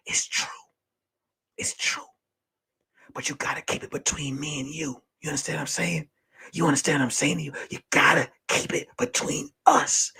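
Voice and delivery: hushed, low, secretive whisper; conspiratorial whisper. Retold or imitated. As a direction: confide it under your breath, as in a retold secret. Pulls her aside to confide secret.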